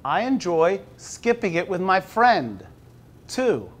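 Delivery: slow, measured picture-book reading; reading to children. Acted. Teacher reading the book aloud slowly to the class. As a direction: read it slowly to five-year-olds.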